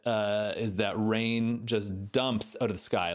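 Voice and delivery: usual speaking voice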